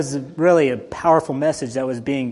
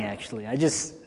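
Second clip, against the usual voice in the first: usual speaking voice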